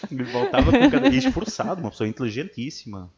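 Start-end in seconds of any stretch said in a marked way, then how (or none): 0.1-1.8 laughing. Real, not acted.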